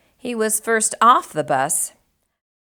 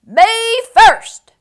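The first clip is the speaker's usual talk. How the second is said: high, loud "Me first!"; pushy pig yelling to be first; demanding squeal; cried; 13 semitones higher, 10 dB louder than usual. A narrator's reading. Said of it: "he cried".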